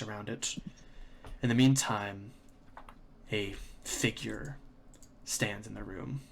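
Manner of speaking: slow, hushed scene setting; game master narration. A narrator's reading. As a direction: paint the scene slowly and softly.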